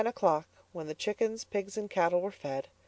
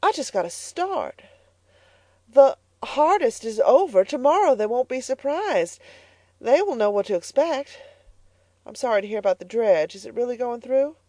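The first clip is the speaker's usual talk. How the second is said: put-on higher, eager girlish voice; a hopeful girl cheering herself up; young Elnora; 7 semitones higher, 4 dB louder than usual. A narrator's reading. Elnora reassuring her mother about school, higher girlish voice.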